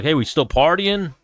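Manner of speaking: mock-cheery invitation. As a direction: pretend to check in brightly. Mock example of texting knocked-out fighter.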